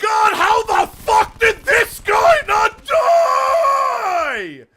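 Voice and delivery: high wail with long stretched final words; howling disbelief. Real, not acted. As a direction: howl it out in real frustration. Enemy would not die.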